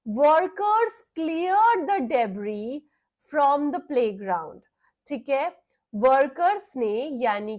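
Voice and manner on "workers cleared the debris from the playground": very slow, clear example sentence; slow reading. Shown as a demonstration. Teacher reads the example slowly, very slow. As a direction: stretch each word for learners.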